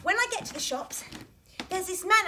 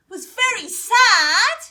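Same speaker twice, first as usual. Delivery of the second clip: sadder, drawn out; 3 semitones higher, 10 dB louder, slower than usual. Shown as a demonstration. Told it was sadder, [name] wails that Mum was very sad. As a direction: stretch "sad" out slowly, louder, with a rising wail. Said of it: "Well, she was sadder than that".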